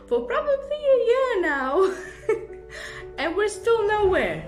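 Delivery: high, loud, breaking into laughter; laughing exasperation. Real, not acted. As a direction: laugh helplessly through the words. Laughing at endless renovation.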